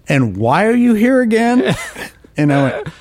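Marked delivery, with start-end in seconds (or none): 0.1-1.7 high, amused disbelief in a mock senior colleague's voice; a mock doubting veteran asking why you came; incredulous question. Veteran orthodontist doubting the newcomer, imitated with high amused disbelief. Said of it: "And he goes".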